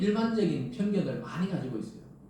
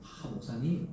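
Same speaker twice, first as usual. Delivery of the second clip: soft Seoul manners; 5 semitones lower, 6 dB quieter, slower than usual. Retold or imitated. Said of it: "좋기는 참 부드러워요".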